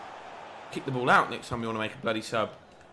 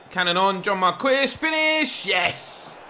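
goal celebration; 7 semitones higher, 4 dB louder, much slower than usual. Real, not acted.

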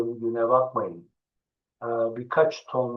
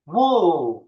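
loud, higher "Wolf" with a long vowel; raised-voice teaching shout; calling out loudly; 4 semitones higher, 6 dB louder than usual. Shown as a demonstration. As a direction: shout the word for pupils to echo. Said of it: "bağırarak söyleyelim".

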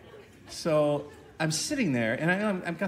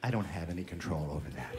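mock attendant; 12 semitones lower, 12 dB quieter than usual. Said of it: "She's like".

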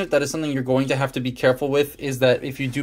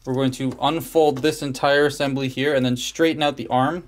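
ordinary talk, nothing marked

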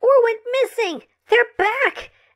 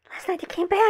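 frightened hushed; 3 semitones lower, 6 dB quieter, faster than usual. Acted. Terrified caller on phone.

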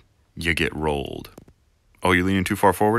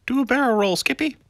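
much higher, cartoony call; high squeaky voice; 5 dB louder than usual. Star Fox quote.

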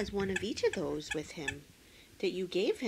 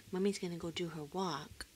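usual speaking voice

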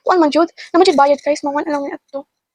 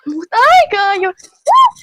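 excited cheer; 5 semitones higher, 5 dB louder, much slower than usual. Real, not acted. Hyping up viewers.

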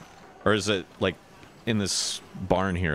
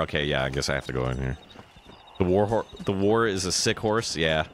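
ordinary delivery

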